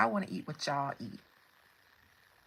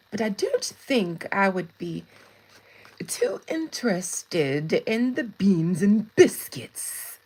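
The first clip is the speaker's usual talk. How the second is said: lightly put-on English accent, louder and emphatic; mock English accent; 6 dB louder, slower than usual. Greeting a viewer from England, in a playful English accent. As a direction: play at being English, drawing out key words.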